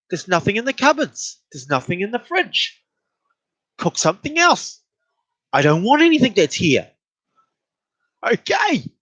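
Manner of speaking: high-pitched retold bickering; exasperated bickering. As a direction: act out a food squabble, voice climbing. Acted back-and-forth quarrel about food.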